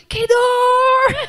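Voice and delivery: high loud cry of pain; reliving the cry at the birth. Retold or imitated. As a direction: yell it out as if in labour.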